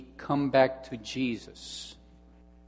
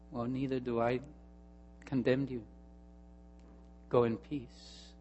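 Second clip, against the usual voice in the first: softer, slowing on go in peace; gentle sweet words; 10 dB quieter than usual. Acted. Jesus forgives the accused woman, softly and tenderly. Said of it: "sweet words".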